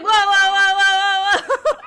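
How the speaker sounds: high, loud, laughing whoas; mock shocked whoa. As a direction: gasp it out in fake shock. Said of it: "And I was like".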